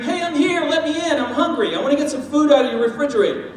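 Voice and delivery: pushy, loud, rushed demands; mock yelling. Rude newcomer banging on the neighbors' door, mock yelling. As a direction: barge in like a rude stranger. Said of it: "yell and scream".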